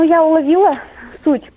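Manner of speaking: ordinary talk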